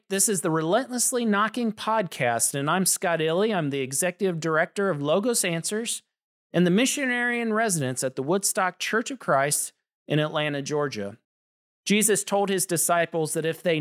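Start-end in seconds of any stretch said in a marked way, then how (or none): none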